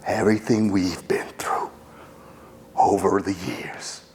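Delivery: urgent whispered pep talk. Acted. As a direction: lean in and whisper it to him. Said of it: "I would do that as Dickie".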